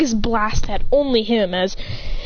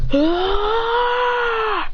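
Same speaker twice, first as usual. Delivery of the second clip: long high rising yell; sustained transformation cry; power-up scream; 12 semitones higher than usual. Acted. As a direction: hold a high "ah" while powering up. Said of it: "As he goes".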